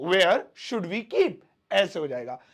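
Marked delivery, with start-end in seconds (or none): 0.1-1.3 each word slowed right down for learners; slow repeat. Shown as a demonstration. Teacher repeating the phrase slowly for students. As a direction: spell it out slowly so students catch it.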